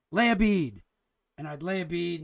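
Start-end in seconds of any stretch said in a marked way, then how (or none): 0.1-0.8 louder, brisk imitation of an instruction; mock welder's order; retold command. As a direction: call it out like the guy giving orders. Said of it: "he'd say".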